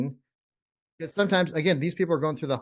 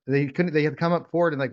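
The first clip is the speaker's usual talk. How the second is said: ordinary delivery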